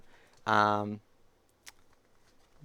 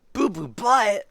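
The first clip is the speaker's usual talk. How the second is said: higher-pitched, disbelieving echo; incredulous repeat; 9 semitones higher, 6 dB louder, much slower than usual. Acted. Reader balks at the hippo's name, incredulous echo. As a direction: repeat it as if you cannot believe it. Reacts to silly name just read.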